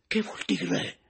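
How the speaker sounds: high, light asking voice; puzzled question. A narrator's reading. Anselmo asks Pepita what she means. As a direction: ask it curiously. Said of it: "domandò il signor Anselmo".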